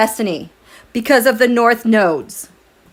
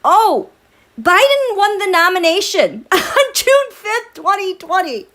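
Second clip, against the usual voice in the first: laughing read of a comment; 9 semitones higher than usual. Retold or imitated. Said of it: "My dear Pamela said".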